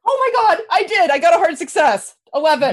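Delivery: high, loud thrilled outburst; excited. Real, not acted. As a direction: shriek with delight at the roll. Thrilled at good roll.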